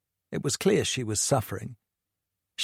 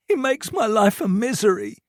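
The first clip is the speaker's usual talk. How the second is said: lightly voiced teen, pitched up; a hint of the aggrieved son; hurt angry teen; 10 semitones higher than usual. A narrator's reading. Josh complains to his mum, pitched up and bitter. Josh's voice.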